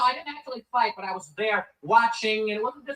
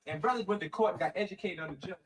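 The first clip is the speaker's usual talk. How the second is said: ordinary talk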